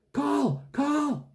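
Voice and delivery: high-pitched excited call; boy's eager, high holler. A narrator's reading. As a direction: call a friend's name from across the playground. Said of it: "a familiar, excited voice calling him from across the playground".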